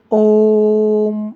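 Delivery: ओम with the ओ held about three times as long; pluta vowel demo. Shown as a demonstration. Teacher showing students a long-held vowel, stretched. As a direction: stretch ओ in ओम, slowly. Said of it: "तीन बार बोल रहा हूँ लगभग".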